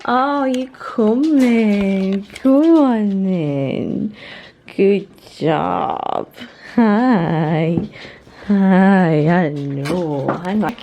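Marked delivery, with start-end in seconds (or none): none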